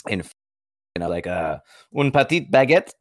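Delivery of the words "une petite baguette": higher-pitched, hesitant attempt at French; halting French order. Customer trying to order bread in Paris, halting French. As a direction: fumble through ordering in French. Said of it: "I tried to order it".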